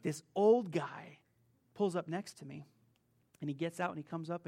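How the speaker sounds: ordinary talk, nothing marked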